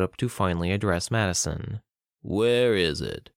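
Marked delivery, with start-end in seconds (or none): 2.2-3.3 slow, stretched-out question; menacing drawl. Acted. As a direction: draw each word right out. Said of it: "he drawled".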